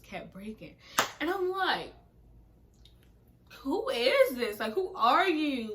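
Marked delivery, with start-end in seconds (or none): 3.6-5.7 high, loud, slow questioning; indignant retold challenge; exasperated disbelief. Retelling her disbelief at his coldness.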